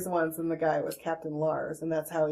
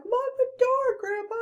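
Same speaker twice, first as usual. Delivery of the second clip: very high mock protest; squeaky-high acted retort; imitated niece's retort. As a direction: imitate a mortified young relative snapping back. Retold niece's high-pitched retort.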